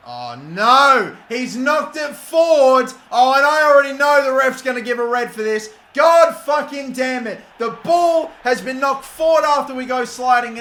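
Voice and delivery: shouting in real frustration at the foul; furious shout. Real, not acted. Gamer shouting at the screen over a red card, furious. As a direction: yell it out, raging at the game.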